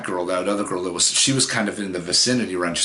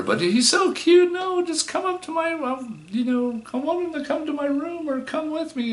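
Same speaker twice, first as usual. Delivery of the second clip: put-on drunk girly voice. Tipsy girl luring him to her room, high girly whine. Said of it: "And I hear her".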